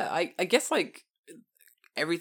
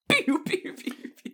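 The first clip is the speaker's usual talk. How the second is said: pew pew; 3 dB louder, slower than usual. Pretend. Cannon fire sound effect.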